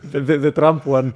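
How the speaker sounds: laughing through the words; giggling answer. Real, not acted. Host clarifying which crazy guy, laughing. Laughing while naming Trump.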